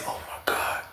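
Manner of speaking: barely voiced whisper of shock; stunned whisper. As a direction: breathe it out in horror. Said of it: "I said".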